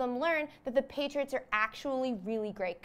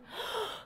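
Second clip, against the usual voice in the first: mock gasp; 11 semitones higher, 8 dB quieter than usual. Shocked reveal of the culprit.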